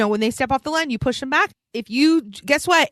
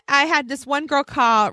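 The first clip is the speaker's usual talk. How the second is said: ordinary talk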